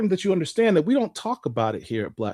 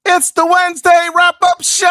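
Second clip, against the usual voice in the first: hype shout; 11 semitones higher, 10 dB louder than usual. Acted. Shouting the show's name.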